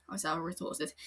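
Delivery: usual speaking voice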